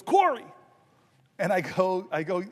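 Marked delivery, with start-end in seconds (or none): 0.0-0.4 a mock president calls the name out high and loud; hammed-up greeting call. Biden on the phone greeting Corey with a loud high call. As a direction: call the name like an excited old friend. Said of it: "and he goes".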